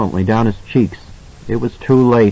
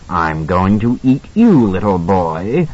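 menacing dog man; 3 semitones lower, slower than usual. A narrator's reading. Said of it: "the dog man answered".

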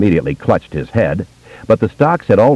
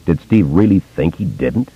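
ordinary delivery

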